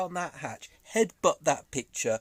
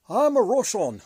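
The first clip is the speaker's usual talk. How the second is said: higher, louder mock Russian accent; attempted Russian accent; 6 semitones higher, 7 dB louder than usual. Retrying Putin's voice after apologising, badly faked Russian accent. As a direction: have a clumsy go at a Russian accent. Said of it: "I can't do an, a Russian accent".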